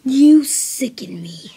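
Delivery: slow, unvoiced contempt; disgusted whisper. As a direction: spit it with disgust. Disgusted rebuke.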